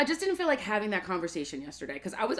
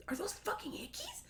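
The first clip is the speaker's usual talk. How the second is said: mock discreet shock, high and much softer; scandalized friend; 10 semitones higher, 13 dB quieter than usual. Friend asking beside her at brunch, scandalized. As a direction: imitate a high, soft scandalized question. Retold friend noticing hickeys at table.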